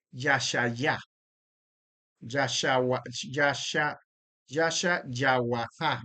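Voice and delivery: "Yasha-Yahuahá" broken into syllables slowly; sounding out the name. Shown as a demonstration. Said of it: "usted está diciendo algo como".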